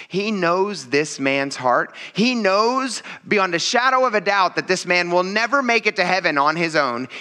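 usual speaking voice

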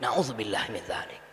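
breathless hushed whisper of refuge; whispered invocation. Real, not acted. Preacher whispers his dismay at the idea, hushed. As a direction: whisper it as a shocked aside. Pious exclamation against a wrong.

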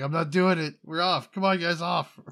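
loud, high, panicked refusal to fly; panicked shout. Pretend. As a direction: yell it like you're bolting off the plane. Panicked refusal on snake plane.

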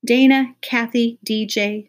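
put-on a teacher calling the noisy kids to order; stern teacher calls out three names.

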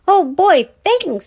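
high, loud delighted squeal; thrilled boy. A narrator's reading. Little boy thanking Brother for letting him help, thrilled. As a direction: burst with joy.

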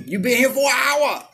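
high loud scolding worker; mock buffet worker. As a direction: shoo the diner away.

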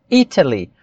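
"Italy" with a fully sounded T; British pronunciation. Shown as a demonstration. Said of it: "Exatamente com o som de T".

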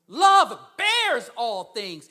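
slow, loud proclamation; emphatic scripture. Real, not acted. Preacher to congregation quoting the Bible, slow loud proclamation. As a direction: boom it out slowly.

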